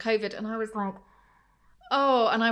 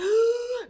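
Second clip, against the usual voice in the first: gasp; 12 semitones higher, 4 dB louder than usual. Retold or imitated. Shocked mother retelling clinic.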